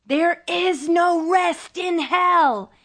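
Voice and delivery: high, slow, drawn-out narrated shriek. A narrator's reading. Tormented lady answers Boyle in hell, high shrieking voice. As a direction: cry it out high, stretching each word. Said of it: "she shrieked".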